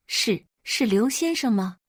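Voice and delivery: timid tearful teacher. Acted. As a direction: stammer it out shyly, close to tears. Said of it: "一个怯生生的声音响起，带着浓重的鼻音". (怯生生、带着鼻音的老师声音)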